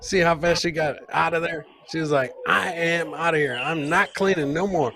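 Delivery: ordinary talk, nothing marked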